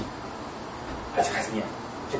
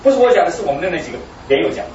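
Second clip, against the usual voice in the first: ordinary talk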